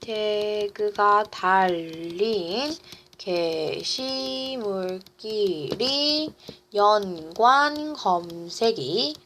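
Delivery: slow, drawn-out syllables; measured write-along delivery; slow dictation. Real, not acted. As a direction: pace each word to the pen. Dictates while writing on board.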